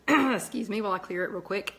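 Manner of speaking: ordinary delivery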